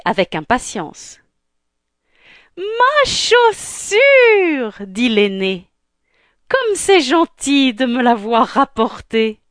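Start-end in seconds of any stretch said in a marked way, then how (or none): 2.6-4.8 very high, long-drawn squeal; exaggerated shriek of delight. Elder sister greedily claims the shoe. 6.5-9.4 high-pitched, affected thanks; gushing stepsister. Acted. Elder sister gushes to the servant.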